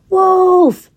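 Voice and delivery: high, loud annoyed alarm cry; shouted wolf. A narrator's reading. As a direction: yell it at the one who won't move. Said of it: "he shouted again".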